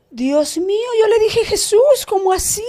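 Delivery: high-pitched, incredulous outburst; retold shocked cry. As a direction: cry out to Jesus in dismay.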